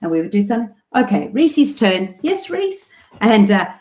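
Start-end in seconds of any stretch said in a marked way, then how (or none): 0.9-2.9 slow turn call, lifting very high; train conductor parent. Retold or imitated. Parent giving the other twin his turn, conductor-like. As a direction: hand the turn over in a high, inviting parent voice. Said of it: "think of myself as the train conductor".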